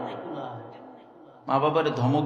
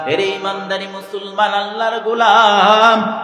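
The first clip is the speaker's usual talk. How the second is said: melodic exhortation; 3 semitones higher, 9 dB louder than usual. Acted. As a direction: rouse the congregation in a singing sermon cadence. Chanted sermon building to climax.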